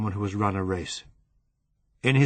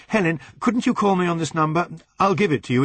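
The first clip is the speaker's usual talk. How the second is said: 1 urgent; 8 semitones higher, 5 dB louder, faster than usual. Acted. Urgent plea on the phone.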